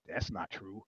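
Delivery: unvoiced leering whisper; creepy whisper. Friend on the bus whispering to a woman, creepy whisper. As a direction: lean in and whisper it slyly. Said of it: "he goes".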